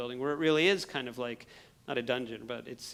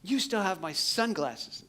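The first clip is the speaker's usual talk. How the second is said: demanding; 6 semitones higher, 4 dB louder, slower than usual. Retold or imitated. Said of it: "But I'm like".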